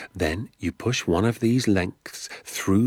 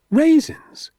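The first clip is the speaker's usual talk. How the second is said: high, loud squeak of disbelief; incredulous; 7 dB louder than usual. Acted. Danny can't believe the secret is raisins, squeaked high. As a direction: shoot the word up in amazement.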